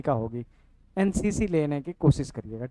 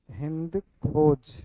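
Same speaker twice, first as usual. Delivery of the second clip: drawn-out, careful enunciation; slow, deliberate naming; 3 semitones lower, much slower than usual. Real, not acted. Teacher giving students the app's name, slow and deliberate. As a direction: say the name very slowly for note-taking. Spelling out app name for viewers.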